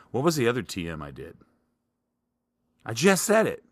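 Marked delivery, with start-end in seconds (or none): 2.9-3.6 exasperated. Real, not acted. Forgot his own idea.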